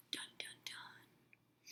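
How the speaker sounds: slow, breathy dramatic whisper; mock suspense whisper. A narrator's reading. Narrator teasing listeners at a chapter end, slow hushed whisper. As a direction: hush it out like a cliffhanger sting.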